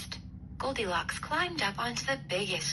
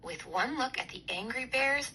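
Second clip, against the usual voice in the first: ordinary delivery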